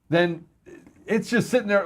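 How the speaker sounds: ordinary talk, nothing marked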